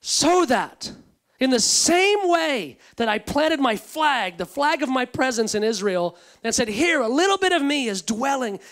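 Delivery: loud, building sermon voice; full-force preaching. Acted. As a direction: preach it out with rising fervor.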